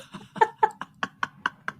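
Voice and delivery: high, breathless laughing fit; helpless laughter. Real, not acted. Runner cracking up at her own request, helpless laughter. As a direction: laugh until the words are gone.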